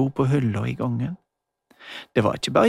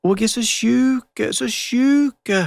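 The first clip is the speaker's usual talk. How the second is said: a child playing the sick grandmother, wailing; sick granny play voice; 10 semitones higher, 5 dB louder, slower than usual. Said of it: "Hun var svært lik Magda i stemmen".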